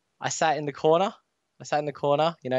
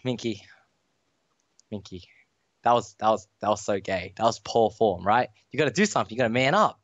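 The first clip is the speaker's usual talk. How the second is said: inner voice to himself; 7 semitones lower than usual. Retold or imitated. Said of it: "to myself, I'm like".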